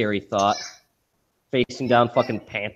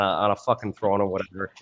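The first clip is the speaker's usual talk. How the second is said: ordinary delivery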